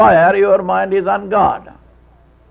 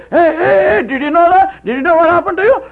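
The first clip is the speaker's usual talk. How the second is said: higher and louder, urgent hey hey; worried passer-by shouting after the Indian; anxious calling out; 10 semitones higher, 4 dB louder, faster than usual. Retold or imitated. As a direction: call out anxiously to a stranger. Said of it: "He ran anxiously".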